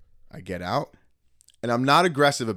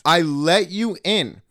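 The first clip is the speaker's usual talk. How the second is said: slow, high, louder retold protest; insistent protest; 6 semitones higher, 5 dB louder, much slower than usual. Retelling his defence to the driver, slow and insistent. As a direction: drag out each word to drive it home.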